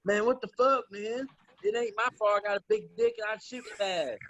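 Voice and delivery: imitating Anthony. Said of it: "He's like".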